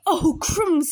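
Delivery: loud, high exclamation; startled, voice jumping up; alarmed snake. Acted. Snake sees the Gruffalo, alarmed.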